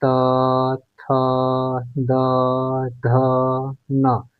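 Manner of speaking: त, थ, द, ध, न said one at a time with pauses; spelling out consonants. Shown as a demonstration. Teacher demonstrating dental consonants one by one. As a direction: sound out त थ द ध न slowly, tongue on the teeth. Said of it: "त वर्ग को देखेंगे".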